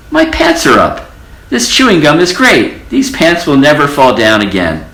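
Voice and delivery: very high delighted exclaim; joyful cry. A narrator's reading. Old mouse rejoicing to the children. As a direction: burst out with relief, then settle. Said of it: "cried the old mouse".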